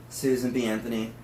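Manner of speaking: usual speaking voice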